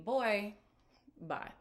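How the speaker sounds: ordinary talk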